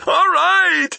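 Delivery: high, loud panicked scream. A narrator's reading. Thief giving in under torture, high screaming. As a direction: scream it in surrender. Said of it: "the man screamed".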